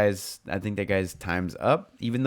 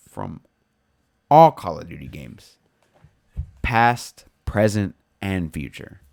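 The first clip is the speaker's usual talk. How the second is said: slow, weighty announcer delivery with loud stressed words; dramatic news read; 3 dB louder, slower than usual. Acted. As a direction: read it like an ominous breaking headline. Dramatic headline-style announcement.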